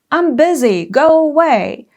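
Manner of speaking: curt, loud, dismissive; blunt dismissal; rude brush-off. Shown as a demonstration. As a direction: snap it off impatiently. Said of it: "is what you don't say".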